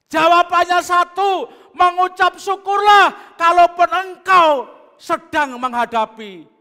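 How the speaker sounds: loud, high sermon delivery; preaching at full force. Acted. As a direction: preach it hard to the congregation. (khotbah berapi-api dengan suara lantang)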